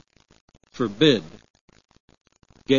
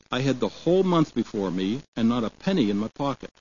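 usual speaking voice

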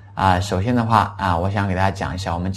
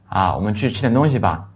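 ordinary talk, nothing marked

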